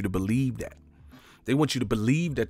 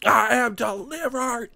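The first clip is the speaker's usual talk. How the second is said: mock ecstatic testimony; 11 semitones higher, slower than usual. Streamer imitating a man praising jelly babies, mock ecstatic preacher voice. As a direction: testify like you've been saved by candy. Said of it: "something that motherfucker would say".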